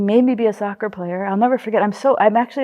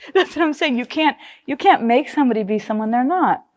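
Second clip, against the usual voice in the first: normal talking voice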